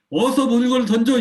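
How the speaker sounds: high, loud furious scolding; angry yell. Shown as a demonstration. Parent exploding at a child who threw something, yelling. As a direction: blow up at the child. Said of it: "감정을 분리해내지 못한 거예요".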